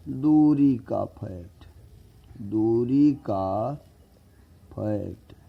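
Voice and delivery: slow, stretched words; drawn out. Shown as a demonstration. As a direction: stretch it so students note it. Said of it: "teacher drawing out a word". (खींचकर धीरे बोलना)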